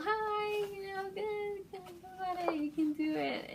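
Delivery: high, soft cheering fangirl voice; high gentle cheer imitation; mimicking the girls. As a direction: coo it like encouraging idols. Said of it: "they're like".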